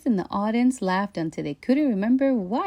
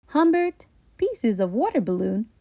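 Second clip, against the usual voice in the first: plain reading voice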